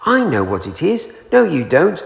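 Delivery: high eager calling out; excited. A narrator's reading. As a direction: chatter with excitement. Everyone excitedly calls out.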